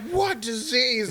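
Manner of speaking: slow, high-pitched disbelief; high, drawn-out alarmed question; shocked. Retold or imitated. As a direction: stretch it out, stunned and alarmed. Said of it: "he's like".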